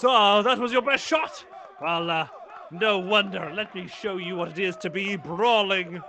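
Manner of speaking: loud, high mocking challenge from a cocky fighter; brawler's taunting shout. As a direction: bellow it like a brawler who shrugged off the hit. Brawler taunts after a hit.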